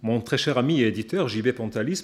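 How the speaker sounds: ordinary talk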